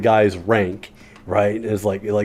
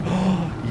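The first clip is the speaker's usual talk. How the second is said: mock gasp; 7 semitones higher, 7 dB quieter than usual. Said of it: "everybody on the plane is like".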